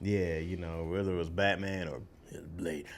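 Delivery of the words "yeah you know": ordinary talk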